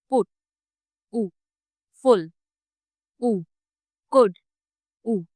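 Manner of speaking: पुट, फुल, कुड with a short tight rounded u, then the u alone; teacher isolating the short rounded u. Shown as a demonstration. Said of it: "ये एक short rounded vowel है".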